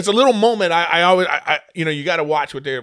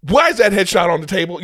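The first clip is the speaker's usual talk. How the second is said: higher, louder angry demand; 6 semitones higher, 7 dB louder than usual. Retold or imitated. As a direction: confront them about the photo. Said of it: "I said".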